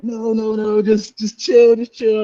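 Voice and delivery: high, urgent mock plea; imitating alarmed pleading. Buddy begging him not to confront the men, alarmed pleading.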